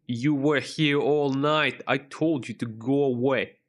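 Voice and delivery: put-on New York accent, slow and gruff. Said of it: "I'm trying to channel my internal New York, uh, accent there".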